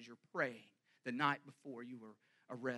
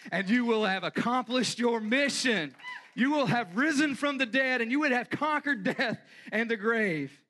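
louder and higher, building to a triumphant shout; full-force preaching; 7 semitones higher, 16 dB louder than usual. Acted. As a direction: preach the resurrection with rising fire. Triumphant resurrection climax.

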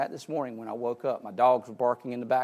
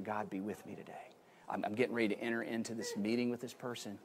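hushed, soft prayer under the breath; soft whispered prayer; 9 dB quieter, faster than usual. Shown as a demonstration. Preacher showing congregation a whispered prayer, soft and hushed. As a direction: murmur it quietly to God. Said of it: "just whispering".

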